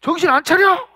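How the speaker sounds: loud, high-pitched acted rebuke; parental scolding. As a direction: play an angry parent telling off a child. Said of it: "막 이러고 막 혼내요".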